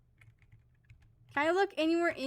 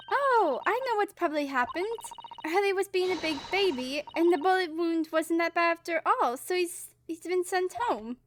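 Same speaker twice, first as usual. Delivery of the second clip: higher-pitched, confident know-it-all voice; chirpy game character; 4 semitones higher than usual. Acted. Explaining to companions that Hurley was sent home, high-pitched character voice. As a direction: pitch it up, brushing the worry away.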